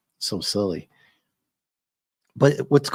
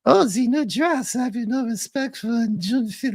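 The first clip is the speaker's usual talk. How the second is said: indignant French lady; 12 semitones higher than usual. Retold or imitated. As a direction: squeal it out like an offended lady in a falsetto. Acting the lady's indignant quote.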